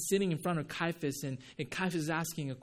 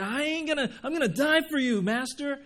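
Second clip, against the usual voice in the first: much higher, loud, quick vow; Peter's bold vow; 10 semitones higher, 7 dB louder than usual. Retold or imitated. Peter vowing to die for Jesus, high, loud and quick. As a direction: swear it eagerly to the master. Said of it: "when he said".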